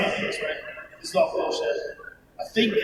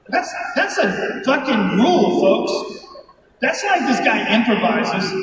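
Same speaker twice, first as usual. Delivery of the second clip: normal talking voice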